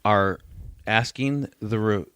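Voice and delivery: usual speaking voice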